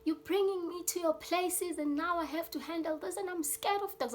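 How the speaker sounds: high, panicky voice of a scared friend; panicking friend. Retold or imitated. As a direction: squeak it out in a panic. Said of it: "My friend started panicking".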